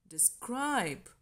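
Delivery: de-SCRIBE, weight on "scribe"; short "de", long strong "scribe" in "describe"; stress on second syllable. Shown as a demonstration. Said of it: "the stressed syllable".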